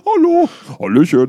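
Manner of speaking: loud silly mock greeting; hammed-up hello. Answering in the greeting skit, loud silly hello. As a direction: belt out a goofy hello.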